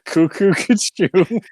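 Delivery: giggly cuckoo. Pretend. Repeating the joke, laughing.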